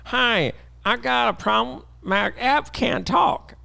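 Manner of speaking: high, slow whiny caller; mock caller voice. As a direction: play a helpless caller. Mocking a developer caller.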